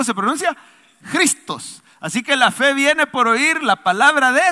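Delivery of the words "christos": "Christos" with a Spanish J sound, Jristos; throaty J at the start of "Christos"; Greek pronunciation. Shown as a demonstration. Pastor teaches the congregation the Greek "Christos", with a J sound. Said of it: "se pronuncia así, mire, con J".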